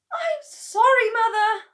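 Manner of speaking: very high, loud, near-tearful plea; apologetic daughter. A narrator's reading. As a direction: beg her mother's pardon. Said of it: "Missus Cranky said".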